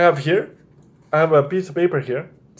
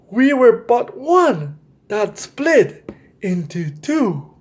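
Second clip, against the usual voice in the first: higher, slower read-aloud with long dramatic pauses; storybook reading; 5 semitones higher, slower than usual. Acted. Reading The Adventures of Brothers C and P to young viewers, in a higher, slower storybook voice. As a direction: read it like a bedtime picture book, lingering on each phrase. Reads picture book aloud.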